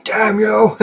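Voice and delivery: high, drawn-out mock curse; hammed-up high-pitched outburst; mock outrage. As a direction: cry it out in pretend fury. Joking outburst aimed at himself.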